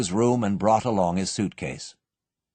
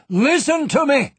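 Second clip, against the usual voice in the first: much higher and louder shout; calling out loudly; 6 dB louder than usual. A narrator's reading. Father calling to the trapped child, loud shout. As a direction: call through the vault door. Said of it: "he called as loudly as he could".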